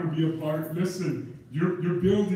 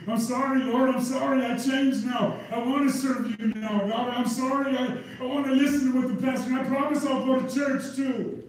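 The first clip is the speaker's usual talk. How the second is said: pleading sinner; 5 semitones higher than usual. Acted. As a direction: beg like it's too late. Dead man begging God too late.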